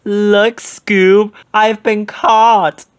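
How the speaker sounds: high, loud, slow playful cry; mock alarm in high Shaggy voice. A narrator's reading. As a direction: ham up being locked up. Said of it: "He cried".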